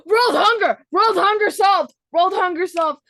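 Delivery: loud, high triumphant cheering; triumphant shout. Pretend. Co-host announces world hunger is solved, shouting in triumph. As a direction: proclaim it like a world-saving victory.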